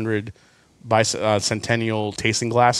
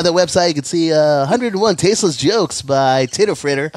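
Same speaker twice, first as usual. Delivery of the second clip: higher, louder hyped radio DJ voice; eighties shock jock; 5 semitones higher, 4 dB louder than usual. Pretend. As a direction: pump it up like a late-night radio host. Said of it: "You sound like an eighties shock jock".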